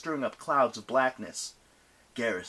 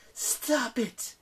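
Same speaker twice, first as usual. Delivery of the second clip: yelling; 13 semitones higher than usual. Acted. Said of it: "Nyx yelled".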